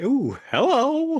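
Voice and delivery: high, slow mock-flirty "Ooh, hello"; cheeky ooh hello. To Tim after he says proof, high, slow flirty ooh. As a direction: camp it up, drawn out and high. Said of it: "I was like".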